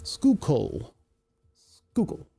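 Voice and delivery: "Schuylkill" with its middle letters dropped, skoo-kull; local pronunciation. Shown as a demonstration. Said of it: "without the inter-, intermediary parts".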